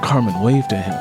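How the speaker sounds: plain reading voice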